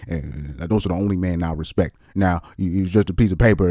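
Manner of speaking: low, soft monotone with a Southern twang; Southern twang preacher. Retold or imitated. Mocking a Southern-twanged preacher's monotone lecture. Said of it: "he puts a little bit of Southern twang in it".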